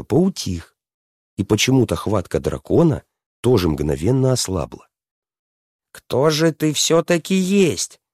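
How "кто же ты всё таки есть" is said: high, slow princess voice; questioning princess. A narrator's reading. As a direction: ask it high and drawn out, as the princess. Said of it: "спросила она".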